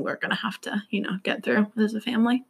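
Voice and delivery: grave little whisper. Retold or imitated. Child tells mom the family secret, whispered. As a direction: drop to a hush for the bad news.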